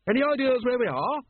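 high, ringing ladylike query; aristocratic lady. A narrator's reading. Lady Ramkin asking Vimes where they are, high and grand. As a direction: ask briskly in a high-pitched grand voice.

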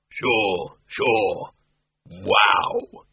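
low, slow genial monster voice; a big hairy creature, easygoing and kind; friendly bogeyman. A narrator's reading. The bogeyman greets old friends in a low friendly voice.

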